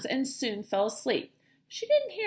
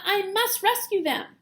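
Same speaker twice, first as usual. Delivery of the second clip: urgent; 9 semitones higher, 4 dB louder than usual. Acted. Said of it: "urgent decision".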